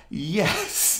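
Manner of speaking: high, drawn-out retold yell; hammed-up high-pitched shout; mock scream back. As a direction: imitate a screamed reply without full volume. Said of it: "he screams back".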